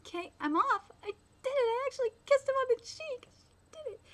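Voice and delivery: high, rising giddy squeal over the kiss. Acted. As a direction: squeal with embarrassed delight. Giddy after kissing him.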